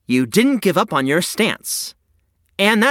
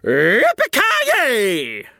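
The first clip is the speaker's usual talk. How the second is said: exclaiming yippee; 3 semitones higher, much slower than usual. Acted. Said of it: "Bill exclaimed".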